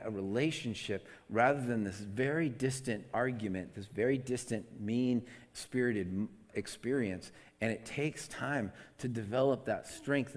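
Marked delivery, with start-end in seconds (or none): none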